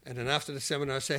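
ordinary talk, nothing marked

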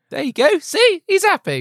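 high sarcastic reassurance; sarcastic cheer. Pretend. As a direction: sell it with fake glee. Mock cheerful insistence.

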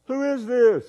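high drawn-out call into a canyon. Acted. Boy questions the unseen voice, calling out. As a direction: call it high across the distance. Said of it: "he yelled out".

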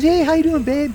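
high-pitched playful imitation of a pitcher charming a wife; teasing high voice, hamming up a ballplayer sweet-talking a friend's wife; mock charming ballplayer. Retelling Peavy charming his wife.

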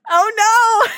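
high, loud "oh no"; a playful cry of dismay at the next pick; mock dismay. Real, not acted. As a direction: recoil in playful horror. Reacting to a companion card.

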